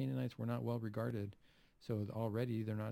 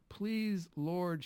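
higher, urgent begging; insistent plea; 8 semitones higher, 4 dB louder than usual. Retold or imitated. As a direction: press him, pleading hard. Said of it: "she insisted".

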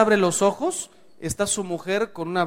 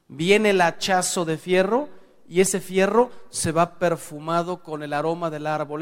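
usual speaking voice

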